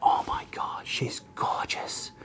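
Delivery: hushed, slow whisper of awe; whispering client in awe of a beauty; awed whisper. Retold or imitated. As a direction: breathe it out in wonder. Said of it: "she'd go".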